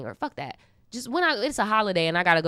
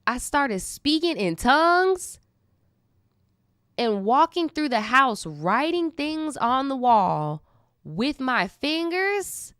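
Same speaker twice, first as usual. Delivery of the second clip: high and slow, stunned disbelief; high, slow, stunned reading of a wild story; incredulous retelling; 6 semitones higher, slower than usual. Real, not acted. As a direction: read it out like you can't believe it.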